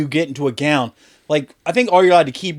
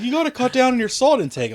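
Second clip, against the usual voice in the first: high nagging doctor voice; 9 semitones higher than usual. Retold or imitated. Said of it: "It's like".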